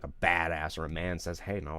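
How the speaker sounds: ordinary talk, nothing marked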